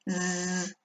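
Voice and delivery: soft buzzing Zzz. Shown as a demonstration. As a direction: hum a voiced Z. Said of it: "pronunciaremos una S sonora". (zumbido de Z sonora)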